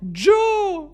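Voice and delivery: high, slow put-on voice, laughing through it; mocking high-pitched character voice; imitating a viral video. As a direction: replay the lockdown video gag in a squeaky voice. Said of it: "ça me rappelle pendant le confinement".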